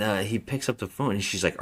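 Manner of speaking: usual speaking voice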